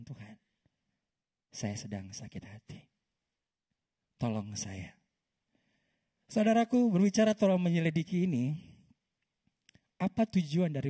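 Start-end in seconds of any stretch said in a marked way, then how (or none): 0.0-5.0 doa lirih. Pretend.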